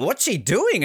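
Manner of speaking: puzzled question. Retold or imitated. Onlookers baffled by McDermott writing in her diary, high puzzled voice.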